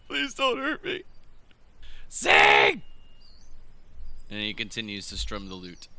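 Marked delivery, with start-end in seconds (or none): 0.1-1.0 pleading. Acted. Captive human pleads with goblins. 2.1-2.8 high, loud barked order; goblin shout. Acted. Goblin orders captive to sing.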